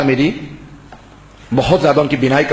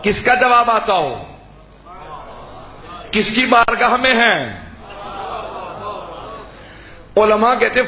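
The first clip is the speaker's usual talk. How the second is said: preaching; 7 semitones higher than usual. Acted. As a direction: preach it to the gathering with full fervour. (بلند آواز میں پرجوش خطابت)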